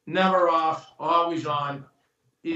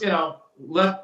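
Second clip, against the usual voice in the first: ordinary talk, nothing marked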